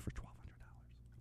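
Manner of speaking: breathy secret whisper; stage whisper. Acted. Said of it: "That's a stage whisper right there".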